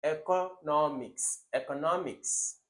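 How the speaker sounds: economics slow and loud, O fully sounded; over-pronounce economics wrongly; mock wrong economics; exaggerated wrong pronunciation. Shown as a demonstration.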